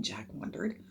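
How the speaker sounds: ordinary delivery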